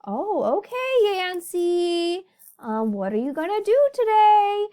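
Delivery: high, loud chirpy greeting; bright hello to a chatty friend; cheery phone hello. Retold or imitated. Greeting friend Yancey on the phone, high cheery hello. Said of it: "I'll be like".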